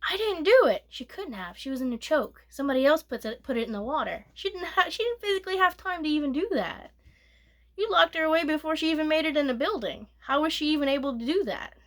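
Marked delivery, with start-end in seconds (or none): 0.1-0.8 high, loud cry of innocence; protesting child. Retold or imitated. Girl protesting innocence, retold. 4.3-11.7 high, fast outraged protest; indignant rant. Real, not acted. Indignant rant defending the girl.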